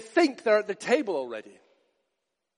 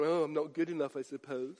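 soft, sulky mock self-pity; self-pitying whine; 7 semitones lower, 10 dB quieter than usual. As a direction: mumble it like a hurt martyr. Self-pitying excuse voiced by preacher.

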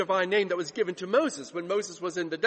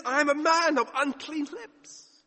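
anguished confession; 8 semitones higher than usual. Acted. Isaiah overwhelmed by sin.

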